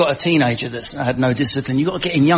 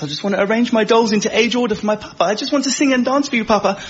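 little princess; 6 semitones higher, faster than usual. Retold or imitated. Daughter voiced as little princess.

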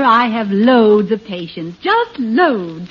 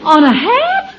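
high-pitched rising disbelief; astonished salesman squeak; incredulous question; 9 semitones higher, 3 dB louder than usual. Acted. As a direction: voice leaping up in disbelief. Salesman baffled again by request.

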